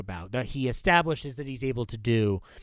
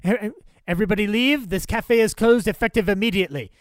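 higher, louder announcement to clear the room; officious order; 6 semitones higher, 5 dB louder than usual. Retold or imitated. Louis clears the cafe, in a raised, officious announcement. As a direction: bark the closing order to the whole cafe. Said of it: "and he's like".

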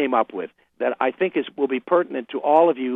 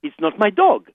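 light mock French accent, a bit higher and louder; Clouseau accent; 5 semitones higher, 4 dB louder than usual. Guest quoting Clouseau to show funny French accents, light mock French accent. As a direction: toss off a quick Inspector Clouseau line with a French accent. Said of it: "think of Clouseau".

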